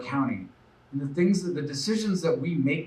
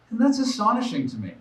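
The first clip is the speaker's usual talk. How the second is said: indignant disbelief; 9 semitones higher, faster than usual. Real, not acted. Candidate reacting to the grand jury report, outraged disbelief. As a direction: pitch jumps way up in outrage. Indignant about threats to chief.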